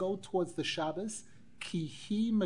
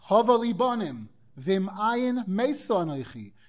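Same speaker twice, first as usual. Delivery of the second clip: acted-out ultimatum, higher and louder; desperate demand; 5 semitones higher, 6 dB louder than usual. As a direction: demand it as a mock threat, raised voice. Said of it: "put a gun to his head and said".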